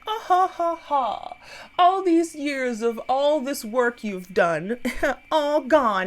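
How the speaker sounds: high mock villain cackle then gloating; hammed-up evil gloating laugh. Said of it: "laughing, like".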